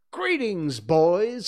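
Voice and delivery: high, loud hearty greeting; ringing call to the band; cried out. A narrator's reading. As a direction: hail the boys at full voice. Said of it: "he cried".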